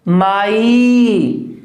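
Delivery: माई stretched very long and punched; stressed syllable. Shown as a demonstration. Teacher stressing माई for the students. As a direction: hold माई out slowly and lean on it. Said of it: "stressed हो जाएगा".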